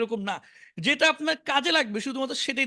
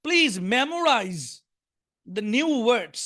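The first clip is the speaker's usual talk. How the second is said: slow model reading; much slower than usual. Shown as a demonstration. Teacher's slow English model line.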